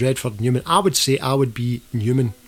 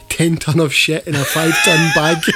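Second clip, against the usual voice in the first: breaking into laughter; 4 semitones higher, 5 dB louder than usual. Real, not acted. Cracks up quoting listener's verdict.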